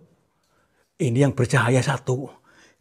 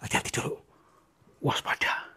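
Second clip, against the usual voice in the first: whisper; 2 semitones lower, 14 dB quieter than usual. Real, not acted. Cautious warning at eerie spot.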